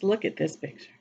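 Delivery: plain reading voice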